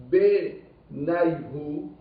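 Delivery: Benayhu stretched very slowly, syllable by syllable; slow teacher's spelling-out of Benayhu; drawn-out Benayhu. Shown as a demonstration. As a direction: draw out Benayhu so each part is heard. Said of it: "Regardez ce mot-là".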